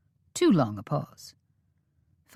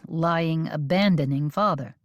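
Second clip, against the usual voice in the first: ordinary delivery, nothing marked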